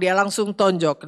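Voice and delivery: usual speaking voice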